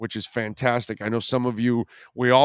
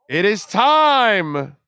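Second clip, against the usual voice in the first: dramatic announcement; 6 semitones higher, 7 dB louder, much slower than usual. Acted. Host summons the Sportstradamus bit.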